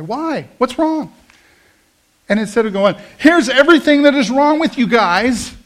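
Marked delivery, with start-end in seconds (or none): 0.0-1.1 high-pitched questioning, mock alarm; puzzled alarm. People asked to change direction, acted puzzlement. 3.2-5.5 loud, high scolding shout; yelling. Acted. Preacher acting out condemning the people around him, yelling. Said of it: "the only way people will hear us is by yelling".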